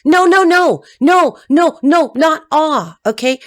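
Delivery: loud, high string of noes; emphatic refusal. Real, not acted. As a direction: shut it down hard, no by no. Emphatically rejects the audience's cooing.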